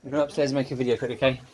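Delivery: loud, quick call to the house. Real, not acted. Man calling out to his family that he's going upstairs.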